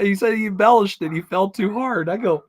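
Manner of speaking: normal talking voice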